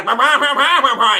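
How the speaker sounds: mock the blaring speakerphone voice; speakerphone babble. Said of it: "And the guy".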